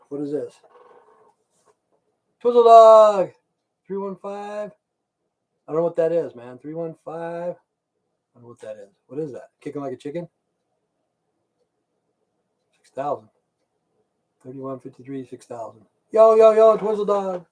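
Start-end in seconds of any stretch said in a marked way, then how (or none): 2.4-4.7 loud shout of a name; calling out. Real, not acted. 16.1-17.5 loud, high hype hello; hype greeting. Real, not acted.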